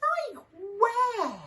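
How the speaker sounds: plain reading voice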